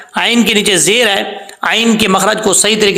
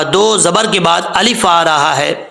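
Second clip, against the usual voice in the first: ordinary talk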